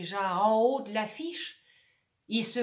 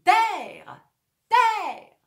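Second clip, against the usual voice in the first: high, loud lookout call; shouting; 10 semitones higher, 10 dB louder than usual. Acted. Actor shouting land in sight. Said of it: "il crie".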